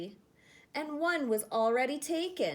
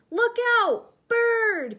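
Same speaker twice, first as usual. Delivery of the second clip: much higher, much louder alarm; panicked cry; 11 semitones higher, 10 dB louder, slower than usual. Acted. Snails warning each other of bird, panicked shout. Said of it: "cried the snails in panic".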